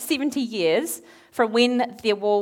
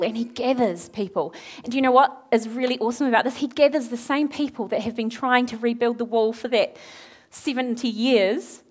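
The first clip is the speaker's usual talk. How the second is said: ordinary talk, nothing marked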